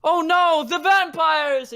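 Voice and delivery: high, loud, slow melodramatic wail; hammed-up damsel actor. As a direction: overact the fake terror for the crowd. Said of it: "and he goes".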